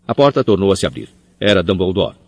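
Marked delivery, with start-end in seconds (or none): none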